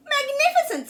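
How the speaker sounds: high, loud delighted cry; high, loud excited praise. A narrator's reading. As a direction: gush with delight. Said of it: "said Elizabeth".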